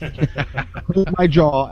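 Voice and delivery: slow, laughing "Clipped my jaw"; laughing repeat. Real, not acted. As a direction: savor it through a laugh. Repeated slowly while laughing.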